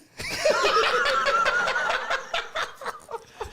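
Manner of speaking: loud, high peals of laughter; long laughing fit. Real, not acted. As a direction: burst out laughing and keep going. Cracks up retelling friend's question.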